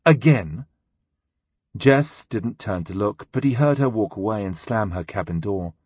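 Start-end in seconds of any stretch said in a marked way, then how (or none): 0.0-0.7 cold scornful rising question; icy contempt. Acted. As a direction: throw it back with icy sarcasm. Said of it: "Glein's voice sounded icy with contempt".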